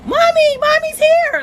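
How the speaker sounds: put-on very high little-girl voice calling Mommy; imitating a toddler. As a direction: squeal it like a small child. Mother voicing toddler daughter.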